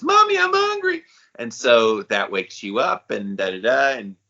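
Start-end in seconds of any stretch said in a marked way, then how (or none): 0.0-1.0 very high-pitched imitation child; mock small-child voice, way up high; little munchkin. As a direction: whine for mommy like a hungry kid. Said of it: "a munchkin comes and says".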